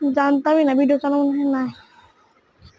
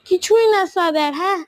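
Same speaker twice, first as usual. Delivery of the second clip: normal talking voice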